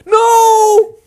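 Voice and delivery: very high, loud scream of no; terrified refusal. Acted. Scared student refusing to go to the city, mock scream. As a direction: scream it in hammed-up panic.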